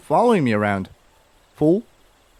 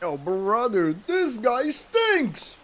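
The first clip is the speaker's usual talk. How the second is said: silly voice; 9 semitones higher, 6 dB quieter, much slower than usual. Pretend. Mocking game character.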